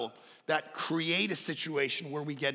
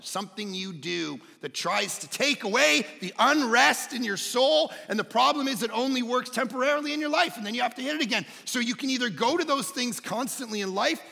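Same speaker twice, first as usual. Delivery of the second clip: raised, driving sermon voice; full-force preaching; 8 semitones higher, 6 dB louder than usual. Real, not acted. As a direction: hammer the warning home, high and loud.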